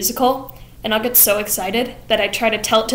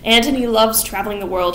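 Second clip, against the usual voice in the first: usual speaking voice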